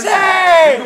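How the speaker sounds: loud angry shout. Real, not acted. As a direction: yell it to cut in. Angry outburst at the others.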